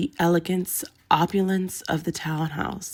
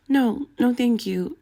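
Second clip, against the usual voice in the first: hiding nerves; 6 semitones higher than usual. A narrator's reading. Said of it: "trying to keep her nerves out of her voice".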